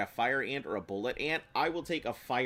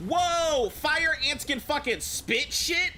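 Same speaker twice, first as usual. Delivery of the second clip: startled shout; 7 dB louder, slower than usual. Real, not acted. Fire ant spits at him.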